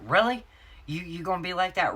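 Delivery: high, loud, incredulous challenge; upset husband. Retold or imitated. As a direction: imitate a husband's indignant disbelief. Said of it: "when I'm getting upset".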